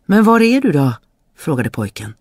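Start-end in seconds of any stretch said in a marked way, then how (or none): 0.1-0.9 higher, louder questioning call. A narrator's reading. Boy asks the hidden voice where it is, calling out. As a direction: call out to someone you can't find.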